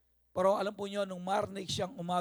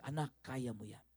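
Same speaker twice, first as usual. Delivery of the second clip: softer, lower, gently encouraging mother; gentle mother's encouragement; 6 semitones lower, 9 dB quieter than usual. Retold or imitated. Mother softly reassuring her doubting child, quiet and tender. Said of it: "But mother always say".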